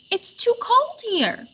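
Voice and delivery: a small flower crying out at the cold; cried out. Acted. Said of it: "cried Daisy Locks".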